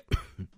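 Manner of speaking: a short rough cough; chesty cough. Real, not acted. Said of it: "I'm coughing again".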